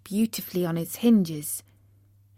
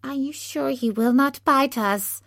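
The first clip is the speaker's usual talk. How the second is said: high, timid little mouse; timid squeak, pitched up; 6 semitones higher than usual. A narrator's reading. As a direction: ask hesitantly as a small worried mouse. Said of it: "asked in a timid voice".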